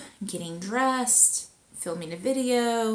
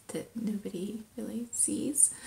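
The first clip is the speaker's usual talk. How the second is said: whispered aside; 8 dB quieter than usual. Real, not acted. Self-deprecating aside.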